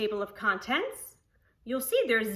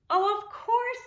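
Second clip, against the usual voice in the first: much higher, bright agreeable friend; Cherry Sue voice; 10 semitones higher than usual. Acted. Cherry Sue agreeing to Poppleton, much higher eager voice. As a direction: chirp it up high as the kind neighbor.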